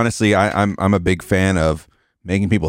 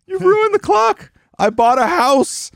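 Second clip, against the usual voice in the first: very high, slow imitation wail; hammed-up despairing cry; mock distraught outburst; 4 dB louder, slower than usual. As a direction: imitate someone melting down, high and drawn out. Quoting a favorite overwrought outburst.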